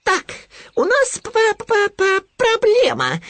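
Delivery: very high voice, stuttering on the p; self-important piglet. A narrator's reading. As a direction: squeak importantly and stumble into the word. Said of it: "важно обратился Хрюня". (важно, тонким голосом, заикаясь)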